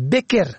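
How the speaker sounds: بکر with "e" after the ب, "beker"; short front "e" opening بکر; first vowel kasra. Shown as a demonstration. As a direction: say بکر as BE-ker. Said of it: "به کسر اول".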